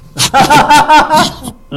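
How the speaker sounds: high, loud burst of laughter. Real, not acted. Teller laughing at his own joke, loud high laugh. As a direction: crack up at your own joke.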